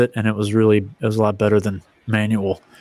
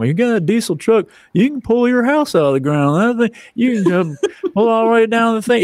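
Excited Southerner; 9 semitones higher than usual. Retold or imitated. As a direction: rave about the diesel truck in a Southern accent. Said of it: "I call Excited Southerner".

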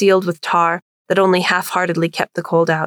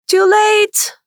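high, loud taunting holler; 4 dB louder than usual. Acted. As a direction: holler it back over your shoulder. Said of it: "Mark hollered back".

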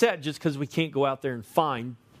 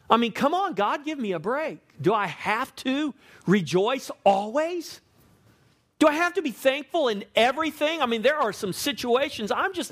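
mock whining complaint; 7 semitones higher than usual. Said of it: "mock complaint to God".

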